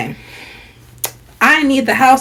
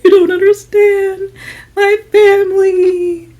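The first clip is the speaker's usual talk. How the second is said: high, drawn-out imitated wail; mocking high-pitched sobby plea; mock crying; 11 semitones higher, slower than usual. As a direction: mimic a tearful wail, stretching the words slowly. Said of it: "He's out there crying".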